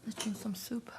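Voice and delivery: soft whisper at the table. Real, not acted. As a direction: whisper it, savoring the bite. Whispering during meal.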